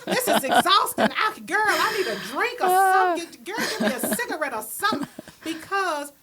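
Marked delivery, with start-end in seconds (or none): 0.1-5.1 put-on unload to your girlfriend after a long day; tired, laughing rant to a friend; exhausted venting. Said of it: "get in the car and be like".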